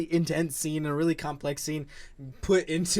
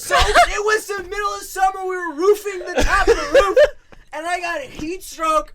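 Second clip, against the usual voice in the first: much higher, louder words cracking with laughter; laughing rant; 11 semitones higher, 5 dB louder than usual. Real, not acted. Defending his tears to friends, laughing rant.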